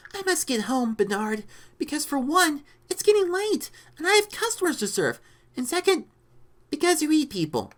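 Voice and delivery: high-pitched woman's character voice; Rita. A narrator's reading. Rita answering the lizard who eats people, high-pitched voice. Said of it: "Rita replied".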